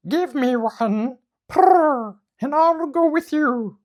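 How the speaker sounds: high, loud bird voice, drawn out slowly; trilling pheasant. Acted. Pheasant lands and asks Momotaro, slow high trill.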